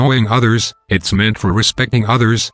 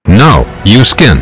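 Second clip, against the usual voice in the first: loud refusal; 8 dB louder than usual. Acted. Refusing again loudly.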